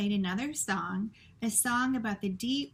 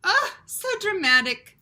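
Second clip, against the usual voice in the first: put-on high, loud French-accented scoff; mocking French mother; 10 semitones higher, 10 dB louder than usual. Antoinette mocking Lester's drama in a French accent. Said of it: "said Antoinette".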